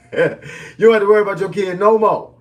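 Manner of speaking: gleeful laughing punchline. Real, not acted. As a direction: crack up as you say it again.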